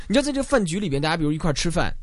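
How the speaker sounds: ordinary delivery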